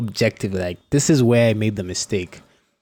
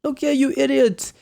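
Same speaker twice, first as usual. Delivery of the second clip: high-pitched mock coach yell, insulting the player; angry coach yelling; 10 semitones higher than usual. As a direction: play the furious coach scolding from the sideline. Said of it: "the coach shouts at you".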